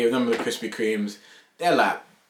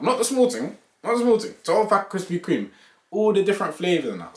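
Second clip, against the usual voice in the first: excited brag; 10 semitones higher, 3 dB louder than usual. Real, not acted. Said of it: "bragging about the twelve pack".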